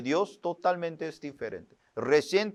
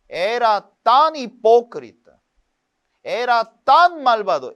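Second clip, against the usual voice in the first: louder, higher, pressing on "tan"; impassioned confession; 6 semitones higher, 6 dB louder than usual. Real, not acted. Preacher confessing his old despair, impassioned. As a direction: confess it with full force.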